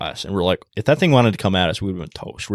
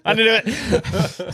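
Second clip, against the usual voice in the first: much higher, slow, laughing; laughing mock volunteer; 13 semitones higher, much slower than usual. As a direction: play the reluctant volunteer through laughter. Joking volunteer quote while laughing.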